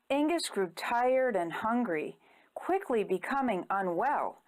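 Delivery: usual speaking voice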